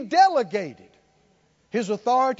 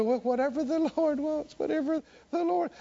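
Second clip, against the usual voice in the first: soft, higher mock-pious voice; falsely humble; 6 semitones higher, 4 dB quieter than usual. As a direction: imitate meek resignation, quietly. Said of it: "think you're being humble".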